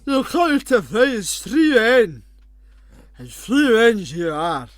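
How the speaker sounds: loud, slow, high mock Scottish war cry; hammed-up Braveheart Scottish accent. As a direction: bellow drawn-out words in a bad Scottish accent. Parodies Braveheart accent, unintelligibly.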